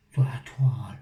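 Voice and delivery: whispered repeat of "Sur la toile"; unvoiced whisper of "Sur la toile"; whispered echo. Shown as a demonstration. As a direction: breathe "Sur la toile" as an aside, no voice. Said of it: "l'accent du sud que je prends".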